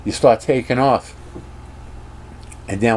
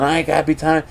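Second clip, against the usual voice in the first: half-hearted irritated yelling; Han Solo mock yell; 7 semitones higher, faster than usual. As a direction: grumble back in acted annoyance. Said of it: "Han Solo's yelling".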